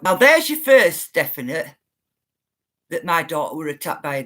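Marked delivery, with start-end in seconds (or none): none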